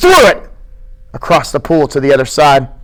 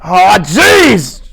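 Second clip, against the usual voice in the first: resigned; 5 semitones higher, 3 dB louder than usual. Acted. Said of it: "George said resignedly".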